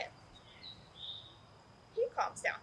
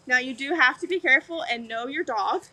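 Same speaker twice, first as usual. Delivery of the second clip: normal talking voice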